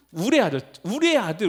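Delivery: ordinary delivery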